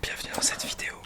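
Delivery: soft ASMR whisper; right in your ear. Pretend. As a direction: breathe it into the mic.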